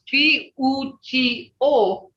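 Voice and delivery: "P U G O" sounded one by one, slow and high; spelled-out sounds. Shown as a demonstration. Teacher showing how sounds help pronounce duck, spelled out. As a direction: draw out each sound of "P U G O" separately. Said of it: "if you use the sounds".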